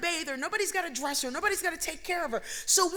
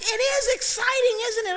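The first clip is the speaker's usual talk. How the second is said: excited doctor; 5 semitones higher, 5 dB louder than usual. Retold or imitated. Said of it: "He's like".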